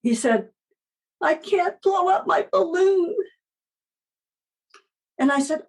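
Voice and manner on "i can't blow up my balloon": put-on high-pitched little boy's whimper; mock tearful wail of a small child; imitating crying child. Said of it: "and he was crying".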